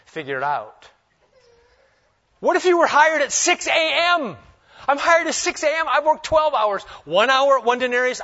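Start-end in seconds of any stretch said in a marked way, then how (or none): 2.4-5.9 higher, louder outrage at a full day's work; indignant worker. Acted. Preacher voicing the worker hired at six, loud indignant protest. 5.9-8.2 higher, louder complaint about the long day; indignant protest. Acted. Worker hired at dawn complaining to the master, loud indignant.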